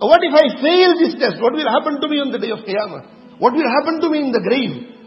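voiced fear of the hereafter; anguished fear. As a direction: cry out the dread of the test. Preacher voicing a tested man's fear.

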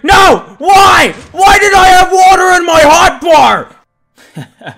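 loud high slow wail of despair; anguished shouting. Real, not acted. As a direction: drag the why out in anguish. Despair shout after mistake.

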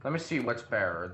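ordinary talk

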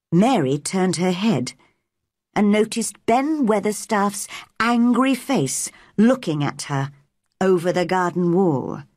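ordinary delivery

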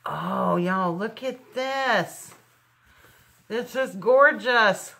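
drawn-out, delighted gushing; excited. Real, not acted. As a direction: gush over it, stretching the words out. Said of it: "I got all excited".

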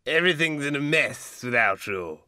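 mildly plaintive grievance; complaining. A narrator's reading. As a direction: complain like a needy old baronet. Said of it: "Sir Pitt complained".

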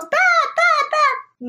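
high panicked yell; yelling at a bat in the bedroom. Retold or imitated. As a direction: shriek the word in alarm. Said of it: "I yelled".

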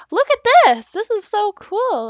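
loud, high thrilled shout; excited. Real, not acted. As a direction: burst out with delight. Finds something amazing.

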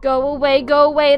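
loud, frightened shout to go away. Acted. Fran yelling at a scary vision, loud and frightened. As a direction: yell it to drive the thing off.

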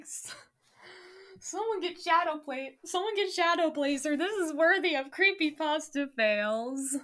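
amused disbelief. Real, not acted. Laughing disbelief at own story.

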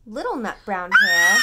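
booming, bright title name; excited read-aloud shout; loud story voice. Acted. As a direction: call the name out big for the baby. Grandparent reading, child nearby.

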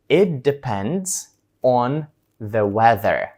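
very slow, deliberate answer; slow model answer. Shown as a demonstration. Teacher acts out a slow answer to the trip question. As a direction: draw each word out for learners. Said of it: "І ви відповідаєте".

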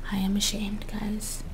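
ashamed whisper; whispered mock shame.

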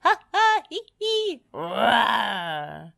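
high cackling laugh into a dragon roar; playful villain laugh, ending in a growly roar; mischievous cackle and roar. A narrator's reading. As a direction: cackle high, then roar like a pretend monster. Spud cackles as pretend dragon.

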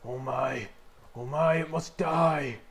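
hushed-then-rising wildlife narrator voice; nature documentary. Pretend. Skeleton in its natural habitat.

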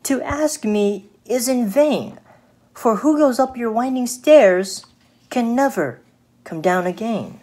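storybook fly, high and firm; little fly refusing. Acted.

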